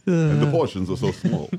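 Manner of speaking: put-on Portland accent. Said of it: "that's a great Portland accent".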